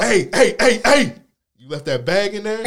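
loud, high shouts after someone; retold urgent hollering; calling out. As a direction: yell to stop somebody walking away. Acts out man calling after them.

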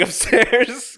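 ordinary talk

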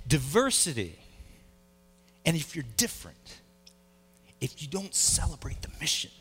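low, soft, earnest sermon voice; hushed preaching. Acted. Pastor urges the church to unite, soft earnest preaching. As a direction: draw the congregation in quietly.

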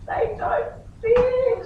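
high, drawn-out whine of despair; hammed-up tearful wail, high and slow; mock wail. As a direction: imitate a distraught daughter through a door. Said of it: "I hear this voice".

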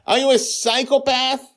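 very high, loud accusing shout. A narrator's reading. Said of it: "Justin is shouting".

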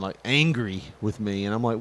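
ordinary talk

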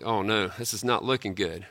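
a quiet sinking oh no; mock dismay. Pastor voicing the worker's dread, soft worried mutter. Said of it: "you're like".

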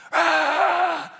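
a high, loud sideline yell; mock parent scream. Pastor imitating parents screaming at four-year-olds, mock parent scream. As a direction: belt out a parent's shriek at a T-ball game. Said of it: "parents are screaming".

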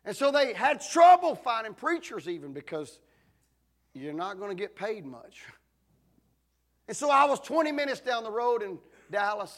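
loud rising preacher build; preaching at full force. Real, not acted. Preacher to congregation, building to a loud climax. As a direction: drive the story like a pulpit climax.

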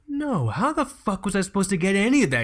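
high, indignant mock outburst; exasperated retort. Son answering his dad after the doctor leaves, exasperated.

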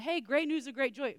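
excited announcement. Acted. Angel announcing to shepherds.

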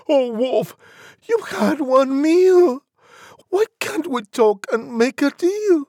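high, loud piggy squeal; frantic squeal of a cornered piglet; squealing little pig. A narrator's reading. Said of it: "The little pig began to squeal".

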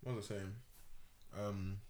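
talking with food in mouth; words round a bite of food; mouth full. Real, not acted. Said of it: "I got food in my mouth".

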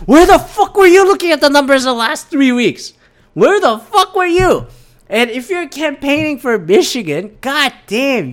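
furious, shout it at him; real angry outburst. Real, not acted. Ranting at Trump's claim.

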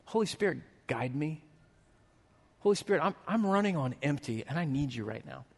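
quiet prayer. Shown as a demonstration. Pastor modeling a quiet prayer in tired moments, hushed. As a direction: pray it under your breath. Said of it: "just saying, like".

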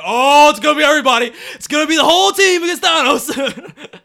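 loud, high excited retelling; re-enacted fan excitement at full volume; excited outburst. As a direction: blurt it out, hyped up and near laughing. Excited reaction to a quote.

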